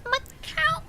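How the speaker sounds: high, squeaky meow with a K; squeaky cat meow. Retold or imitated. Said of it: "He meows like".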